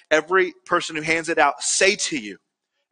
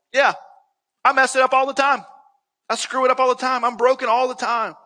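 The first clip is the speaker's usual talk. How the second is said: higher-pitched, emphatic admission; frank confession; 6 semitones higher than usual. Acted. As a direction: own up to it readily, pitched up. Voicing a frank confession of failings.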